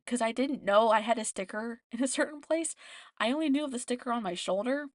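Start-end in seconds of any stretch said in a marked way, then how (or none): none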